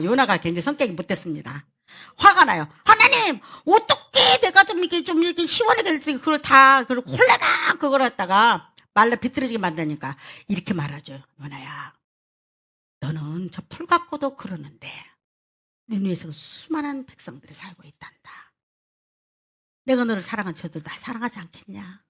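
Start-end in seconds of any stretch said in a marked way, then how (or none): 2.8-10.2 much higher and louder, petulant protest; whining complaint. Acted. Jonah complaining to God. 11.4-22.0 God gently reasoning with angry Jonah, low and soft; gentle God voice. Acted.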